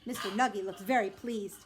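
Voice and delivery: plain reading voice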